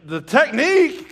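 high, loud, drawn-out incredulous question; retold exasperation. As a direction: throw it back in mock disbelief. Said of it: "and I'm furious".

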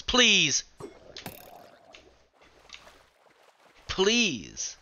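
high, loud begging cry; desperate pleading scream. Real, not acted. Gamer about to die, desperate pleading scream. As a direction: plead for your life at the top of your lungs. Begging while under attack.

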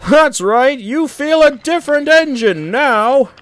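high, loud teasing call; jeering shout to someone across the yard. A narrator's reading. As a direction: call it out tauntingly, pitched right up. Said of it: "called a voice".

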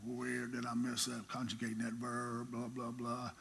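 soft, low mutter over his books; muttering to himself. Retold or imitated. Preacher muttering to himself over his Greek verb, quietly. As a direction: puzzle it out under your breath.

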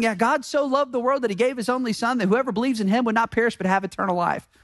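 rattled off fast by heart; rote recitation. Retold or imitated. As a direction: reel it off like a memorized line. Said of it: "such a recorded statement".

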